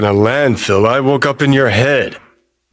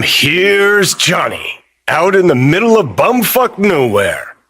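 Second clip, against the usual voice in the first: mock show announcer; 8 semitones higher than usual. Johnny introduces himself in the middle of nowhere, mock announcer. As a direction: stretch it like a show host's big entrance.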